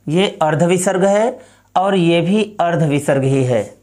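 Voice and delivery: ordinary talk, nothing marked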